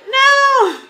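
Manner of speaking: a high, loud, anguished NO; emotional shout. Retold or imitated. Pregnant wife crying out to her husband about sitting, loud anguished shout. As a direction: wail it out like the last straw. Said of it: "I got all emotional about it".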